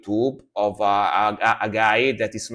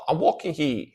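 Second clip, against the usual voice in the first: put-on a lighter, higher New York accent on "walking"; New Yorker accent; 5 semitones higher than usual. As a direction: toss off the New Yorker line once more. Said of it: "Like New, New Yorker".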